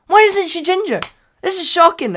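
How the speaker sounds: high, loud, scandalised outburst; mock shock. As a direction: exclaim as if utterly scandalised. Acts own shocked reaction.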